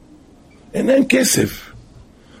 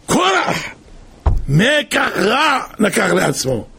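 solemn quotation; 6 semitones higher, slower than usual. Real, not acted. Quoting Ben Ish Chai.

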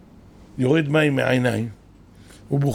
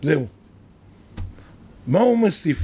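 ordinary talk, nothing marked

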